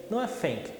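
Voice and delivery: ordinary delivery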